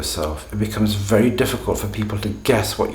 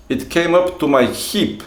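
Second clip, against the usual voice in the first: put-on higher-pitched Russian-accented English; 8 semitones higher than usual. Teacher acting his student describing the snow, Russian accent. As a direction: hip sounding like heap, as a Russian would say it. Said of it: "when you listen to Russian speakers speaking English".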